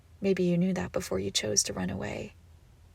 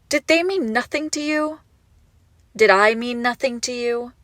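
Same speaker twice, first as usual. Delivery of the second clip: higher and louder, a wounded demand; heartbroken plea; 3 semitones higher, 7 dB louder than usual. Acted. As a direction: ask it like her heart is breaking. Heartbroken accusation.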